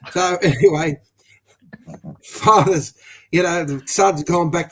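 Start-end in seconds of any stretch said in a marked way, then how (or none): none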